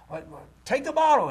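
acted exasperation bursting loud and high; frustrated mother. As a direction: mimic an aggravated mom snapping at her baby. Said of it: "they get all frustrated and aggravated".

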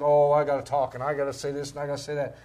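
loud mimicry of compulsive talkers; mock insistence. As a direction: rattle off a mock must-say list loudly. Mimics believers anxious to keep talking.

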